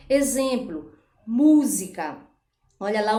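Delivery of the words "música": música with a loud first syllable mú; stress on MÚ. Shown as a demonstration. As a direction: punch the mú at the start of música. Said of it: "a sílaba tônica é a antepenúltima". (música com força no mú inicial)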